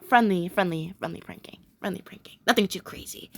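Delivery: low, very soft murmur; hushed aside. Real, not acted. Fan clarifying what kind of pranking she means, hushed aside. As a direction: trail down almost to a whisper.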